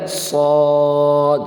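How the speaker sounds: صاد with a thick rounded long vowel; slow, heavy model صاد; heavy full-mouthed saad. Shown as a demonstration. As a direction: hold صاد full and level, like "sawd". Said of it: "پر پڑھے جانے والی letter".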